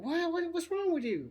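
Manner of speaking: high-pitched incredulous questioning; retold disbelief. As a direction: re-enact baffled protest to a friend. Acted reply to friend's confession.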